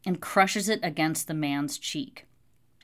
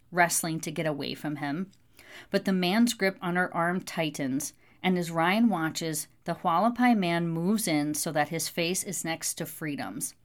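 ordinary delivery, nothing marked

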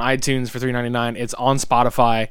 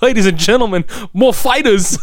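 announcer voice; 8 semitones higher, 6 dB louder than usual. Pretend. Mock announcement of band name.

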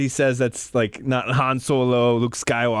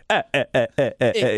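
imitating Nien Nunb; 3 semitones lower, faster than usual. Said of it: "He sounds like the Count".

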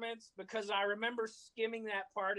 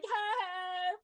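gloating shout; 10 semitones higher, slower than usual. Retold or imitated. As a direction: crow it over them at the top of your voice. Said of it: "and he's like".

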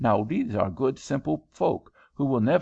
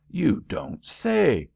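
astonished cry; 6 semitones higher, slower than usual. Acted. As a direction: draw it out in disbelief. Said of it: "cried Headingly".

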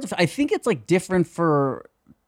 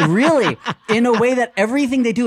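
excited; 8 semitones higher, 4 dB louder than usual. Real, not acted. Excited explanation.

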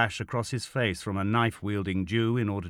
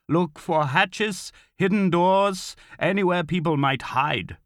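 high, forceful instructions; commanding order; 9 semitones higher, 4 dB louder, slower than usual. A narrator's reading. Officer sending men to search, ordering firmly. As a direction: list each place as an order. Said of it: "Kroll ordered".

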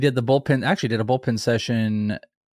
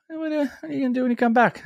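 high fast teasing; 14 semitones higher, faster than usual. Real, not acted. Teasing draft partner.

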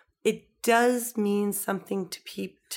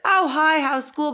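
cheery dad greeting; 8 semitones higher, 5 dB louder than usual. Retold or imitated. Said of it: "And he's like".

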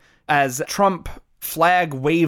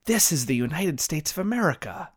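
after a long pause, softer, a hammed-up solemn line; mock heartfelt delivery; 6 dB quieter than usual. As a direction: play it as a mock earnest anchor, dropping the voice. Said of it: "heartfelt pause".

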